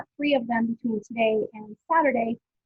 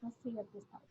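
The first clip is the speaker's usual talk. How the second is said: distant speakerphone; 11 dB quieter than usual. Real, not acted. Switched to speakerphone mid-call.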